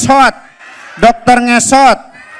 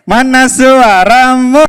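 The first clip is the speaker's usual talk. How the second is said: ordinary delivery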